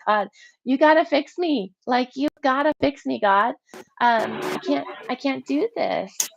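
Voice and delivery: desperate plea. Retold or imitated. Alone in her room, begging God to fix her, desperate. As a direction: cry out for help, begging to be fixed.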